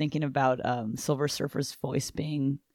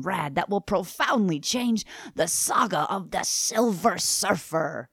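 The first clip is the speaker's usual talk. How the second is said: Stan Lee voice. Acted. Co-host resumes the anniversary solicitation, Stan Lee voice. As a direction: sell it like a hammy comics pitchman from New York. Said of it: "A Stan Lee voice".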